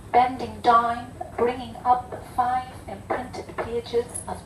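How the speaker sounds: measured poetry reading, paused line by line; poem recitation. Acted. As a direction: recite it deliberately, letting each phrase stand alone. Reads own poem aloud.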